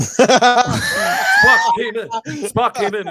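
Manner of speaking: bursting out laughing, very high and loud; laughing shout. Acted. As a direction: crack up while shouting the name. Joking Kirk log, laughing shout.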